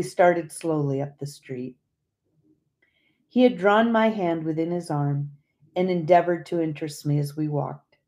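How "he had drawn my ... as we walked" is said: plain reading voice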